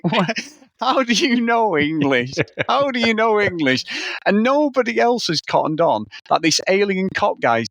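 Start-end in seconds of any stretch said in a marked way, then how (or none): 0.0-3.9 high-pitched, incredulous questioning; mock astonishment. Character questioning the English-speaking alien, mock astonishment. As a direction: act baffled, pitched right up.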